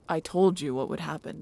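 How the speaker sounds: scolding. Acted. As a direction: rub it in sharply. Bex scolds Briar.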